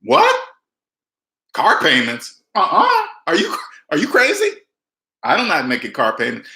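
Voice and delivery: a hammed-up "are you crazy" reaction; incredulous mock shock. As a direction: act scandalized at the very idea of car payments. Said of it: "This is what I would do".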